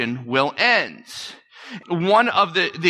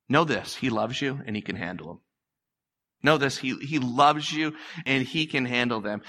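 quiet, lower, gentle preacher comfort; soft reassurance; 3 semitones lower, 5 dB quieter than usual. Real, not acted. Preacher softly assuring hurting listeners of love. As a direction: lean in and comfort them softly.

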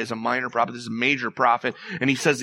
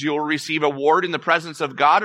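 usual speaking voice

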